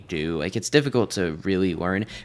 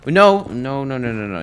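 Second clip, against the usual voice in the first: panicked shout; 4 semitones higher, 7 dB louder than usual. Real, not acted. Getting shot by a piglin.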